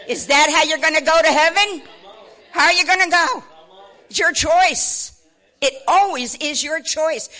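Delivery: loud, high, insistent challenge; full-force pulpit challenge; challenging preaching. Real, not acted. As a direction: press the question hard on the congregation.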